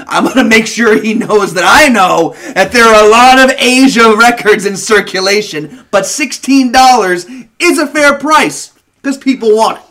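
hammed-up know-it-all laying down the law; imitating boastful record guy. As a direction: declare it loudly, mocking his self-importance. Acting the vinyl guy's boast.

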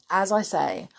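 plain reading voice

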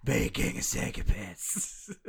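slow, loud, running out of air; hammed-up breathy menace; Batman voice. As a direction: push each word out like a winded Batman. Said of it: "the way he says psychopaths".

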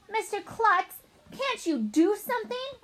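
high-pitched pleading demand; urgent appeal. Acted. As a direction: plead with the principal to step in. Upset pupil appeals to the principal.

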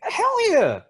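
high, loud emphatic outburst; emphatic hell yeah. Real, not acted. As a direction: blurt it out, fully committed. Emphatic answer about disappointment.

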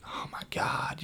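whispered, appalled reaction; hushed dismay. Retold or imitated. Realizing the smell is his friend, whispered dismay. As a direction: breathe it out softly as if caught.

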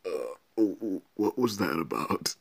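mock groans of someone knocked flat; groaning, bewildered and hurt; acted dazed groaning. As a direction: imitate a battered man coming round. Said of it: "he's laying there in the rubble".

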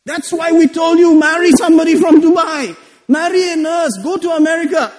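high-pitched scolding relative; lecturing relative. Retold or imitated. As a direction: raise the pitch, nag like an elder. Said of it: "They gave me a lecture".

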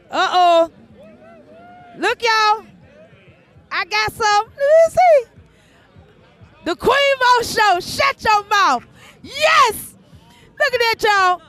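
high, loud joyful cries over the gift; squealing with happiness at auntie's gift; overjoyed shouts. Real, not acted. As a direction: yelp with delight at the present.

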